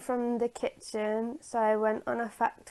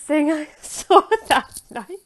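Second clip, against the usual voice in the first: laughing; 5 semitones higher, 10 dB louder than usual. Real, not acted.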